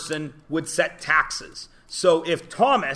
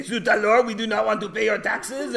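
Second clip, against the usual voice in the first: hammed-up French revolutionary, much higher; bad French accent; 6 semitones higher than usual. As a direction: play a mock-outraged peasant in a bad French accent. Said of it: "in a bad French accent".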